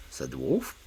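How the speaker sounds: usual speaking voice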